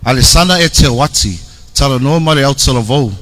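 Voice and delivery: usual speaking voice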